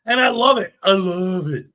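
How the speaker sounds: mock adoration, sinking on the repeat; sarcastic. Real, not acted. Host mocking self-styled journalists, sarcastic. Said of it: "I'm being sarcastic".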